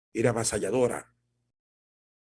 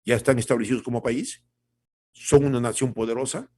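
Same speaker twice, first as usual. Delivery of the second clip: ordinary delivery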